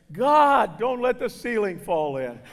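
loud mock cry to heaven; exasperated plea. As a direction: beg God loudly, starting high and strong. Father's prayer after the bed collapses.

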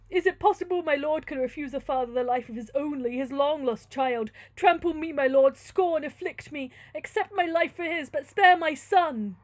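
loud, impassioned begging; desperate plea. Acted. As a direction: implore as a father, rising on the final appeals. Friar begs for his son.

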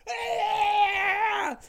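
long, high, loud mimicked scream; imitated screech. Screaming woman turning on the teller, imitated piercing screech. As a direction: let out one sustained screech, mimicking her. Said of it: "she's like".

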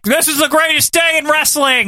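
high-pitched hammed-up announcer gush; mock Gene announcer. Podcaster mocking Gene's hype, hammed-up high announcer. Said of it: "Gene's like".

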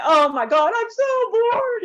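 high, drawn-out, whiny I'm so bored; mock bored whine. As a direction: ham up the boredom to the crowd. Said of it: "And I".

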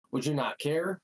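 ordinary talk, nothing marked

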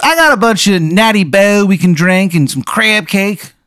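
high-pitched Maryland party-guy caricature; terrible Maryland accent. Acted. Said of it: "this guy's terrible Maryland accent".

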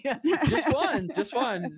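laughing through the words; giggling answer. Real, not acted. Laughing at the phone question.

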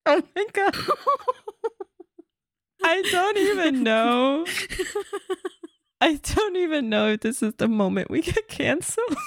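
high, breaking into helpless laughter; can barely get words out for laughing. Real, not acted. Helpless laughter at a dark joke.

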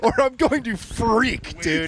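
high, drawn-out mock meltdown; slow, high-pitched TikTok rant; weird TikTok girl. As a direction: stretch it out in a high imitation girl voice. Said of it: "that weird TikTok girl".

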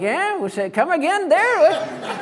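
high, drawn-out comic voice; hard-of-hearing listener. Acted. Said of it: "He is not hard of hearing".

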